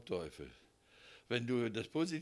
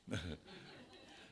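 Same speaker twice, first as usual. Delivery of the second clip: soft chuckle; 4 semitones lower, 7 dB quieter than usual. Real, not acted. Laughing at his own story.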